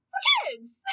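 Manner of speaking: mock-indignant falsetto jab; a sarcastic high squeal at the rating; sarcastic squeak. Real, not acted.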